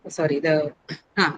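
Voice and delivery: ordinary delivery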